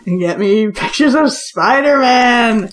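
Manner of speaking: barking newspaper editor. Pretend. Joke shout, slow, barking order.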